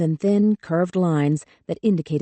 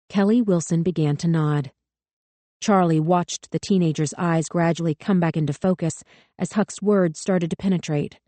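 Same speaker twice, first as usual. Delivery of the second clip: plain reading voice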